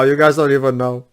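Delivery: ordinary delivery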